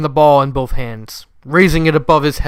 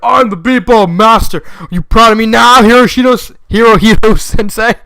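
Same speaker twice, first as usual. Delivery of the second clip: shouting; 7 semitones higher, 7 dB louder than usual. Acted. Joe boasting loudly to his sensei after winning. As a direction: crow it at the top of your voice. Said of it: "he shouted".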